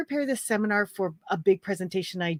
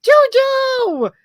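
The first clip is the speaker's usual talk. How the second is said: excited shout; 10 dB louder than usual. Real, not acted. Spots a friend joining live.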